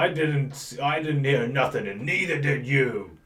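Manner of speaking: loud menacing guard denial; denying guard. Acted. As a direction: shut them down hard. Guard denies, threatening.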